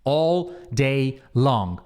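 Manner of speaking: very slow, linked into one unit; slow, as one phrase. Shown as a demonstration. Teacher modelling a phrase slowly for students. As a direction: stretch it out as one chunk. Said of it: "entende como uma coisa só".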